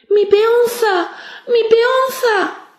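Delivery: put-on high, slow desperate child's cry; shouting. A narrator's reading. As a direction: call out at the top of your lungs. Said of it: "y gritó a voz en cuello".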